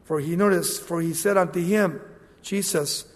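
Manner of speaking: ordinary talk, nothing marked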